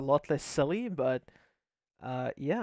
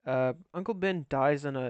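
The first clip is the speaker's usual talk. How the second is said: usual speaking voice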